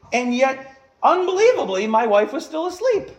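high, loud disbelief; incredulous. Real, not acted. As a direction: sound amazed she slept through. Astonished at sleeping wife.

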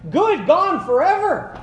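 high, bright mock God, delighted; joyful, high voice of God forgiving; God, cheerfully forgetting. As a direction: play God brushing the sin away happily. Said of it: "He's like".

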